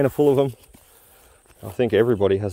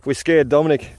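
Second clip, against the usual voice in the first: triumphant cheering; 6 semitones higher, slower than usual. Real, not acted. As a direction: cheer like you won. Celebrating the prank.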